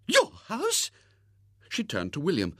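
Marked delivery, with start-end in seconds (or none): 0.1-0.9 high, astonished lady's question; surprised spinster. Acted. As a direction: gasp it like a startled old maid. Miss Rubina surprised.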